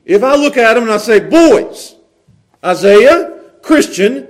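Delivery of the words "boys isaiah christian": firm, raised fatherly call; stern summons. Shown as a demonstration. Father summons his sons by name, stern call. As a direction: call the boys to attention. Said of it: "There's a difference there".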